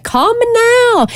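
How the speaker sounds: slow, high Jamaican psychic voice; Jamaican accent. Retold or imitated. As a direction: drawl it like a TV psychic. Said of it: "the one with the Jamaican accent that'd be like".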